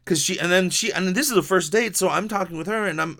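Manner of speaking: ordinary talk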